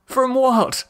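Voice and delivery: a kidnapped naked man's thoughts, rising terror; escalating terror. Acted. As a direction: build toward a near shriek. Captive's mounting terror.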